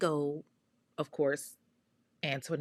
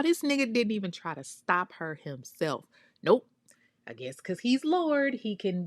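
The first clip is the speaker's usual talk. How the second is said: usual speaking voice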